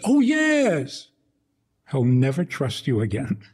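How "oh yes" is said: overeager fake yes. Parent's hollow overeager reassurance.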